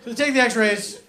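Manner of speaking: ordinary talk, nothing marked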